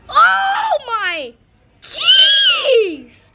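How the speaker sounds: high, drawn-out laughing screams; screaming in awe. Real, not acted. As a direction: scream with thrilled panic. Storm peak, screaming.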